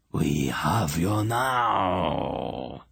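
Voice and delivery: slow, rasping monster growl; slow snarling beast voice; growling hound. A narrator's reading. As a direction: chew each word like a huge hound. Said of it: "it growled".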